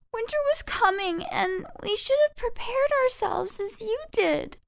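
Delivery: very high, trembling, near crying; sorry little voices close to tears; tearful mice apology. Acted. Mice apologizing to Tweez, high and tearful.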